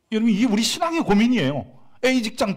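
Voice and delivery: high, loud preacher's exclamation; emphatic exclaim. Acted. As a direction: hit the point hard and high.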